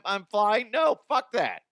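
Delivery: ordinary delivery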